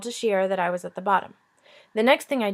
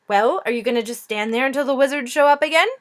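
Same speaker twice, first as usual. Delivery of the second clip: louder, pushy impatient princess; 4 semitones higher, 6 dB louder than usual. Acted. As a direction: prod them to get moving. Impatient princess prods them.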